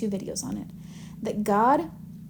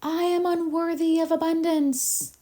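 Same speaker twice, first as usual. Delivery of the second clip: mock scream; 7 semitones higher, slower than usual. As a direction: call it out long and high, as if across a canyon. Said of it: "and screaming out".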